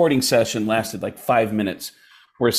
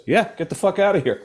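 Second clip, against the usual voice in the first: high, dismissive send-off; retold brush-off; 7 semitones higher, faster than usual. Seth MacFarlane dismissing new actor, retold brush-off. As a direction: imitate someone bluntly getting rid of you.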